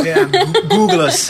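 high, loud laughing call; excited laughing. Real, not acted. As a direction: laugh it out to listeners. Laughing shout to listeners.

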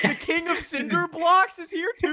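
very high, laughing mock disbelief; mock astonished voice. Teasing his friend's king idea, in a very high mock voice. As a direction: squeak it out like a baffled cartoon.

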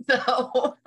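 word through a laugh. Real, not acted. Laughing after own remark.

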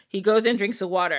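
usual speaking voice

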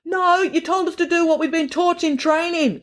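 high-pitched insistent call. A narrator's reading. Teller to manager through bandit screen, calling out. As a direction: argue back from behind a screen. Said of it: "called the teller".

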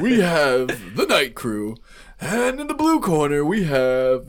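drawn-out mock boxing introduction, loud; hammed-up arena introduction; ring announcer. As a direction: stretch it like a fight-night announcer.

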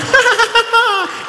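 high, loud cackling witch laugh; witch cackle. Retold or imitated. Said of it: "the Wicked Witch of the West showed up".

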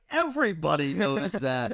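a light imitation of a friend in disbelief; incredulous friend. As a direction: scoff at him lightly. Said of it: "He's like".

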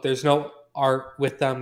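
ordinary delivery

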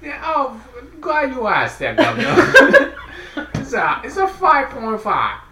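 put-on high, loud, laughing British accent; mock British accent. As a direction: ham up a cheery Brit. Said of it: "hillbilly Australian British".

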